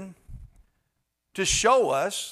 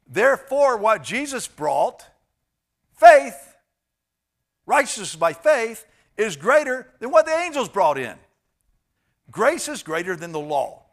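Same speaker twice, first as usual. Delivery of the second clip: loud, high, rising pulpit proclamation; preaching at full force; 5 semitones higher, 9 dB louder than usual. Acted. Pastor proclaiming grace to his congregation, full force. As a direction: preach it out, building to the word faith.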